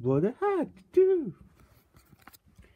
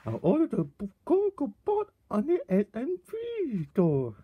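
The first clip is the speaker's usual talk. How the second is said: usual speaking voice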